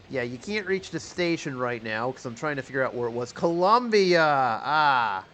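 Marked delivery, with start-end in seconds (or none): 3.3-5.2 excited. Real, not acted. Spots the city he sought.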